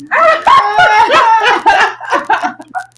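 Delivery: high, loud burst of laughter; cracking up at own imitation; shrieking laugh. Real, not acted. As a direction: scream with laughter.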